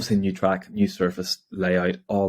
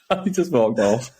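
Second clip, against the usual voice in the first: normal talking voice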